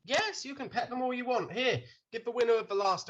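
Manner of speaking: lightly acted, high-pitched indulgent host. Host indulges excited animal petter.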